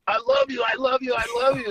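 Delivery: high, gushing fan; starstruck gushing. Retold or imitated. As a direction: fan gushing, lightly hammed. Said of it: "I was, like, starstruck".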